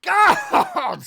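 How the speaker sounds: anguished cry. Retold or imitated. Recalling his stream breakdown.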